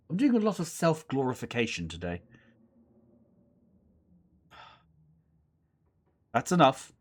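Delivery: ordinary talk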